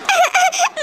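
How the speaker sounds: high, shaky sob; wet sob. Acted. Wife still sobbing to her mother-in-law. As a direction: catch your breath in a sob. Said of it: "पहले तो तुम रोना बंद करो".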